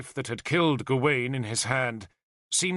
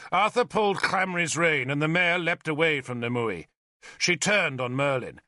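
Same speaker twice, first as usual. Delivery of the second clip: plain reading voice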